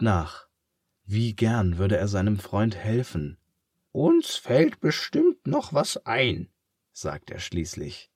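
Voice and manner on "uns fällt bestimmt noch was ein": comforting pig. Acted. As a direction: cheer up your friend in a high pig voice. Charles reassures.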